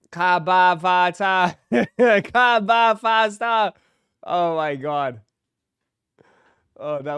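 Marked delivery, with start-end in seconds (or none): 0.1-1.5 put-on loud Boston accent, dropping the r's. Streamer mocking the Boston boyfriend's word game, exaggerated Boston accent. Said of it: "why people make fun of the accent". 2.2-3.7 put-on slow, loud, higher Boston accent. Streamer repeating the word game in mockery, exaggerated Boston accent. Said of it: "why people make fun of the accent".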